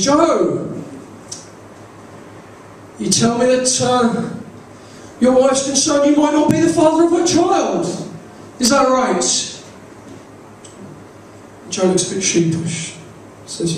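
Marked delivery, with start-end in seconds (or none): 0.1-9.5 high-pitched talk show host confronting a guest; Jeremy Kyle. Acted. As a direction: TV host's leading question, pitched well up. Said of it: "Jeremy Kyle's looking, and he says".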